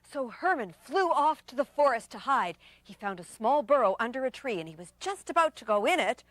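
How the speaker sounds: usual speaking voice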